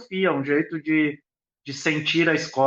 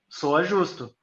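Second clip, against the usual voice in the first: ordinary delivery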